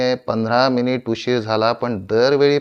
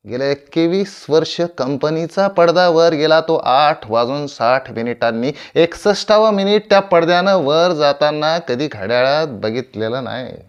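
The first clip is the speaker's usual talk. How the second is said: raised, emphatic stage declamation; louder and higher, boasting with full conviction; proud boast; 4 semitones higher, 6 dB louder than usual. Acted. Veteran actor boasting to his audience of punctuality, proud and emphatic.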